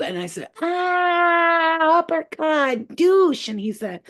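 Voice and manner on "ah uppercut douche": high, drawn-out attack yell; hammed-up fighting shout with a punch sound; battle cry. Little elf uppercutting the alien, high mock battle cry.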